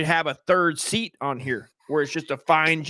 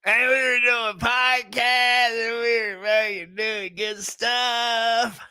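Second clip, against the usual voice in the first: mock dramatic lament; 7 semitones higher, much slower than usual.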